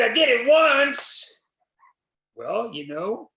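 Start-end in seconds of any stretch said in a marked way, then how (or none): 0.0-1.4 put-on kid's proud claim. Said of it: "And they said".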